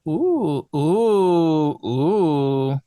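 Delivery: loud drawn-out ooh sounds; crooning ooh noises. Real, not acted. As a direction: swoon with rising then falling oohs. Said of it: "I don't know why I'm making these noises".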